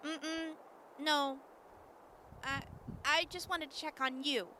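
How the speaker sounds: soft, gentle and concerned. Acted. Siobhan sitting with Johnny, speaking softly and gently. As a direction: ease into it kindly, softly.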